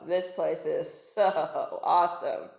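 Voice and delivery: slow, laughing delight. Acted. Narwhal marvels at his new house, laughing slowly. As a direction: savor it with a chuckle. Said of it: "he said to himself".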